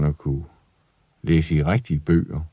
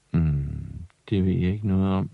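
put-on quiet boy; 2 semitones lower, 8 dB quieter than usual. Ivan quietly answering father.

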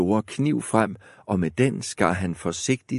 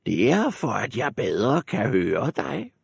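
wolf playing grandmother; wolf as granny; 3 semitones higher than usual. A narrator's reading. Wolf answers the girl, posing as granny.

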